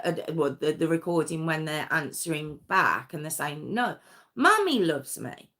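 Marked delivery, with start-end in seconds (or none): 3.7-5.5 put-on high bright defiant boy; boy happily contradicting his father; upbeat child. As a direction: toss it back cheerfully. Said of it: "they were quite upbeat".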